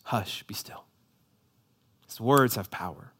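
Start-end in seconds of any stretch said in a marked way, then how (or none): 0.1-0.9 very soft, low, near-whispered hush; hushed command. Acted. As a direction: still the storm with a hush. Jesus calming the storm, quoted.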